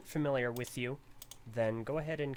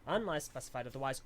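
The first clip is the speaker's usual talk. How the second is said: usual speaking voice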